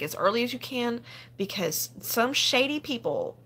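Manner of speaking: ordinary talk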